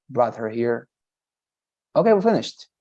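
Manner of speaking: ordinary talk, nothing marked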